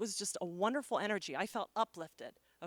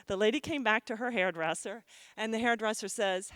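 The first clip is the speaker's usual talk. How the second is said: usual speaking voice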